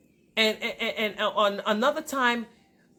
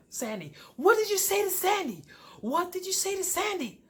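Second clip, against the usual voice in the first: much higher, mock-outraged questioning; imitated angry husband; 10 semitones higher than usual. Husband confronting wife over his ex, mock angry. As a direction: mimic him demanding an answer. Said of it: "a man is getting angry".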